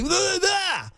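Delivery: high, garbled nonsense sounds; mock French noises. Exasperated student answering his French teacher, hammed-up nonsense noises. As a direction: give up and gargle exaggerated fake French. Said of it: "French is really just making noises".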